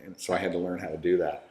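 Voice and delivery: ordinary talk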